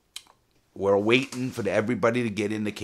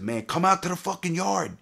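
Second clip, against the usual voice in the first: calling out; 11 semitones higher, 3 dB louder than usual. Retold or imitated. Calling challenge through cell door.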